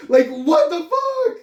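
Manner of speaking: high, breaking laugh; helpless high laughter; laughing hard. Real, not acted. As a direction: laugh through the words, almost crying. Laughing after the Craft impression.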